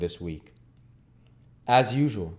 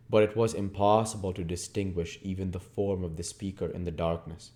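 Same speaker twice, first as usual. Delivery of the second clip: ordinary delivery, nothing marked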